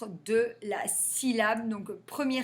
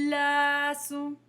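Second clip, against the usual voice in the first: "glaçon" said higher, vowels held; model glaçon; 5 semitones higher, 3 dB louder than usual. Shown as a demonstration. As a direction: draw out "glaçon". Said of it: "Donc, le G".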